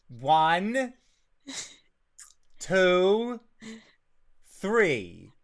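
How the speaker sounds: Kane talking. Acted. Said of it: "That's Kane talking".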